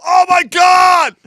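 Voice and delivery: high, drawn-out horrified shout; re-enacted shriek of disgust and alarm; horrified mock scream. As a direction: holler in exaggerated shock. Retells own horrified reaction.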